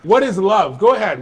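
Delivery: excited. Real, not acted. Reacts to matching answers.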